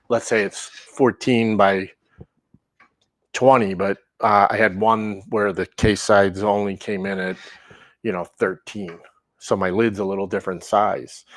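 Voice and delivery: ordinary talk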